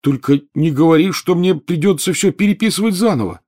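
high, loud wail of dread; dismayed plea. A narrator's reading. Ron begs Hermione about his ruined essay, panicky wail. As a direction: plead in rising dismay.

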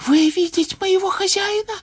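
astonished, very high elf voice; amazed high-pitched house-elf; gasping Winky. A narrator's reading. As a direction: gasp the question in a tiny squeak. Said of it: "ахнула Винки".

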